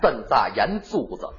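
"针扎眼珠子" slow, low, in Tianjin dialect; Tianjin accent. Shown as a demonstration. Repeating the Tianjin version for his partner, slow and low. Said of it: "您给学学".

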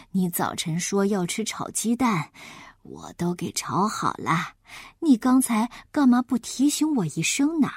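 low granny voice, mildly flustered; forgetful grandma. Acted. Grandma remembers the forgotten eggs, low and flustered. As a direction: scold your own memory like an old grandmother.

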